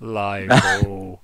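loud, drawn-out demon voice; demonic, reverby. Shown as a demonstration. Game master suggests how the android might sound, demonic and reverby. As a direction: boom it out like an echoing demon. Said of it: "some horrible, weird, demonic reverby".

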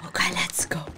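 hushed whisper, barely voiced. Real, not acted. Gamer whispering to herself while sneaking, whispered. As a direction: breathe it out under your breath.

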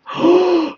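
a sudden high startled gasp; sharp gasp. Real, not acted. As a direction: catch your breath in fright. Jump scare in horror game.

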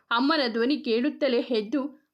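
ordinary delivery

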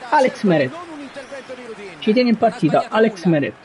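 usual speaking voice

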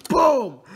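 loud spoken "boom"; mock blast; explosion sound. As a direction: burst out a playful "boom". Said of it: "and then it's like".

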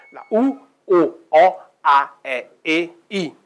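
each vowel sung out clearly; vowel demo. Shown as a demonstration. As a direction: show every vowel, open and closed. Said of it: "invece sono sette in pratica". (vocali scandite una per una)

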